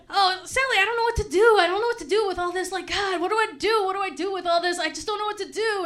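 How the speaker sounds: helpless wail to a friend, acted out; distraught pleading. Distressed griever begging an angry friend for help, hammed-up pleading.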